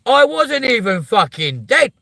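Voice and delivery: indignant emphasis. Real, not acted. Ranting about brothers using card.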